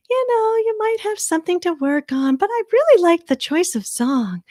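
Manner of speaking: high-pitched, encouraging judge impression. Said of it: "[name] is the judge that is always gonna give you, like, some kind of, like, really cool".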